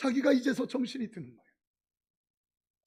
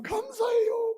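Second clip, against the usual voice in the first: grateful shout; 14 semitones higher, 6 dB louder, slower than usual. Retold or imitated. Husband hugging wife.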